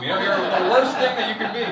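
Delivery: normal talking voice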